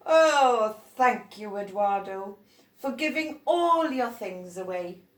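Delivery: grateful grown-up. Acted. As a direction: thank him warmly for giving things away.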